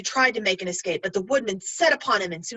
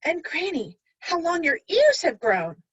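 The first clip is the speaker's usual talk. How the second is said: put-on higher childish amazement; wide-eyed wonder; 7 semitones higher than usual. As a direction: marvel like a little girl. Girl amazed.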